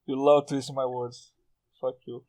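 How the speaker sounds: normal talking voice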